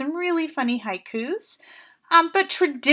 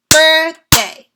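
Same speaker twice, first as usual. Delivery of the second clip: loud, higher, BIRTH-DAY in two punched beats; clapped syllables; 4 semitones higher, 10 dB louder than usual. Shown as a demonstration. Teacher clapping a word together with her class, loud and syllable by syllable. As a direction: call out "Birthday" in two claps. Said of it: "let's do it together".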